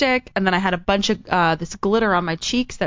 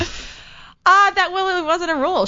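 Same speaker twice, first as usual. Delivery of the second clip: real objection, pitched way up; high-pitched protest; 10 semitones higher, slower than usual. Real, not acted. Storyteller told she doesn't live by rules, protesting. Objects to being called rule-breaker.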